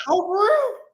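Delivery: high, put-on surprised oh for real; mock surprise. Retelling his reaction to the wife, high mock surprise. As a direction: act pleasantly surprised. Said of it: "I was like".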